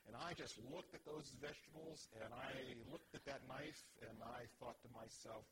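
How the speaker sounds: usual speaking voice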